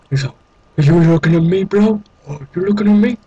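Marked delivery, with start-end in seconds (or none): 0.8-2.0 mock tough taunt. Taunting an Enderman. 2.3-3.2 mock tough taunt. Taunting an Enderman again.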